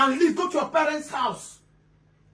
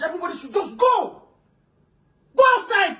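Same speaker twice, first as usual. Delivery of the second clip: shouted order; 3 semitones higher than usual. Acted. Uncle ordering both wives out, shouting. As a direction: yell it at the two wives.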